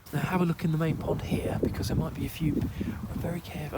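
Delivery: hushed, careful whisper. Real, not acted. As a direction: whisper so nothing gets scared. Sneaking up to the pond.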